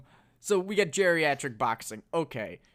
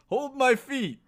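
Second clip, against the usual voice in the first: Stallone impression; 8 semitones higher, slower than usual. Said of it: "who has the better Stallone impression".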